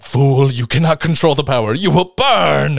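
hammed-up Red Skull menace; mock villain warning; villain line. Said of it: "He's like".

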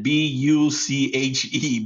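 B U C H E spelled out slowly, each letter separate. Shown as a demonstration. Spelling his mom's nickname for listeners to Google, slow letters. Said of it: "What is it?".

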